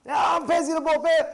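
high, loud mock-argument yell; acted fighting shout. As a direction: shout it like a quarrelling spouse. Acted shouting match between spouses.

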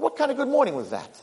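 high-pitched, worked-up protest; indignant complaint. Acted. King questioning the boy's good morning, indignant. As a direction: plead in an aggrieved high voice.

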